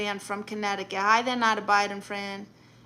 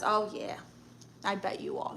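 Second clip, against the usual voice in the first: ordinary talk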